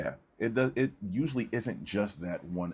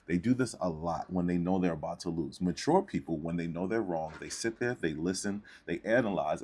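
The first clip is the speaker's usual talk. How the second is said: normal talking voice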